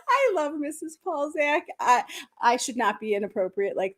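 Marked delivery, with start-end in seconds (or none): none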